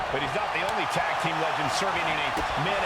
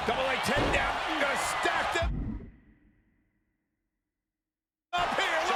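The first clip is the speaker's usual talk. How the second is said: rising, excited play-by-play shout, much higher; excited call; 8 semitones higher than usual. Real, not acted. Commentator calling the pin to the crowd, excited and high. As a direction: call the pin as it happens, voice climbing.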